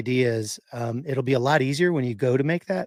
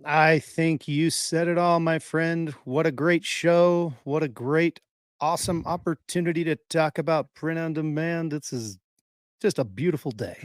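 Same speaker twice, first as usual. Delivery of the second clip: radio voice; 3 semitones higher than usual. Pretend. Co-host signs off when asked for last words, mock radio voice. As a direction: play the smooth radio announcer signing off. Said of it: "It's a radio voice".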